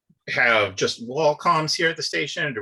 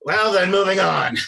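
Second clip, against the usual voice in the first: in-character quip; 8 semitones higher, 6 dB louder than usual. Acted. Commodore breaks awkward silence.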